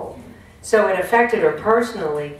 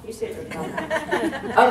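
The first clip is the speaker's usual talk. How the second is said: laughing; 9 semitones higher than usual. Real, not acted. Laughing at a smile in audience.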